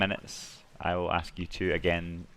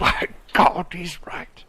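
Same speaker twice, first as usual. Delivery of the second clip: voice changer; 10 semitones higher, 9 dB louder, slower than usual. Pretend. Echo of teammate in filter.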